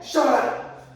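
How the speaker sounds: high, loud flustered shout; embarrassed yell. Retold or imitated. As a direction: shout her down to cover the shame. Yelling at his carried partner.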